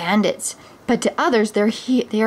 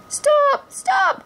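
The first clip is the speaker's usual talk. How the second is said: crying out. Acted. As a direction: call after a speeding coach. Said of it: "cried Robin".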